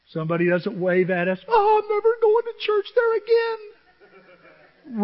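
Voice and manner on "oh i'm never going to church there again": very high, slow, hammed-up whine; hurt mock wail. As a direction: wail like an offended crybaby. Mimics an easily hurt churchgoer.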